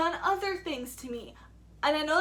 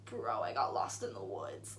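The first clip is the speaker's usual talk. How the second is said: much lower, flat-voiced confession; deadpan to friend; 9 dB quieter than usual. Telling her friend Ivy what happened, low deadpan. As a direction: drop the voice low, dry. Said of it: "and I'm like".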